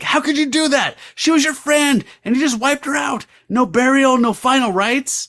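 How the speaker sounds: high, outraged cry; snapping in anguish. Acted. Byron snapping at Damon over the dead friend, high outrage. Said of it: "he snaps".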